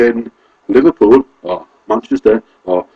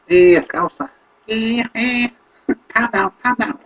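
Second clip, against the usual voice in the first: high mock Scouse accent, dropping softer; cheeky Scouse accent caricature, pitched way up; 12 semitones higher, 4 dB quieter than usual. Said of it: "people from Scouser".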